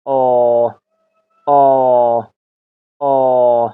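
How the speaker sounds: "آ" held long, each one separate; drawn-out long vowel. Shown as a demonstration. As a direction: stretch "آ" out as a long open "ah".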